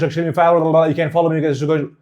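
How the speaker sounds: rushed, fast talking. Shown as a demonstration. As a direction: gabble it out fast. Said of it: "if I talk like this".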